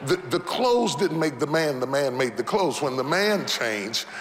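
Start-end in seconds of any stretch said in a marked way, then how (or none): none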